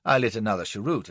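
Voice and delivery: plain reading voice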